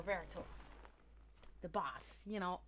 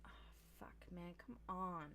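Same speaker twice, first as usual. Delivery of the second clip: low-energy, much softer groan of disappointment; mock dismay; 4 semitones lower, 12 dB quieter than usual. Worker hears coworker is on salads, mock dismay. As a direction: sigh it out softly, let down.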